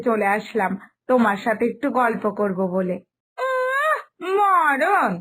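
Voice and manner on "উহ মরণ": scornful exclamation. Acted. Aunt scoffs at fussy mother-in-law.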